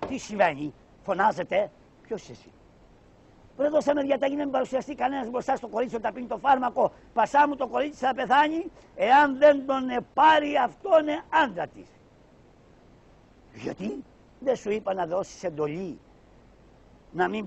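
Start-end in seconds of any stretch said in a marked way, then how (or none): 3.6-11.9 a high, quick pasha voice; a lightly voiced pompous pasha giving orders; high fast pasha voice; pasha scolding. Pasha scolding, then doctor.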